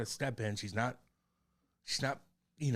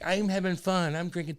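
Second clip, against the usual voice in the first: higher and louder, mocking the wife having fun; mimicking Kate; 7 semitones higher, 4 dB louder than usual. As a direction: play the partner cheerfully tipsy, lightly mocked. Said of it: "And Kate's like".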